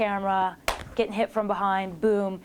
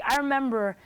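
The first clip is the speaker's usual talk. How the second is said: usual speaking voice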